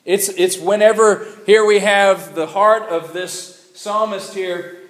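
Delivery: normal talking voice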